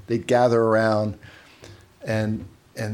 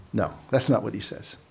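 normal talking voice

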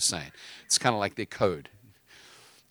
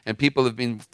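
preaching with force; 3 semitones higher, 3 dB louder than usual. Acted. Preaches at full force to caller.

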